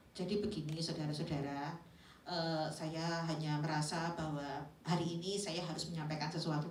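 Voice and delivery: low, soft, monotone address; dull presentation intonation. Shown as a demonstration. Presenter addressing an audience, dull low monotone. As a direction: present it in an unengaging low drone. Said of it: "Bila kita ngomongnya begini".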